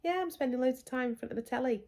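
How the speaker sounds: high, lightly voiced child; imitating daughter. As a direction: play the pleased older daughter.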